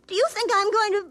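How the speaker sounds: tearful, voice breaking on the question; fighting back tears as she refuses him; choked-up sob in the voice; crying. Acted. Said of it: "so Rosie could finish crying".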